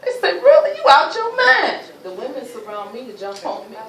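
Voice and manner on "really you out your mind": loud, high, laughing scorn; mocking disbelief at her begging. People mocking her plea.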